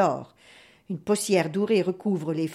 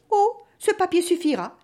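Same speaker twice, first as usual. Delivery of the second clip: high, airy reply; lightly feigned innocence, pitch lifted; sly young woman; 9 semitones higher, 3 dB louder than usual. A narrator's reading. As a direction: toss it off innocently. Marie slyly takes the contract.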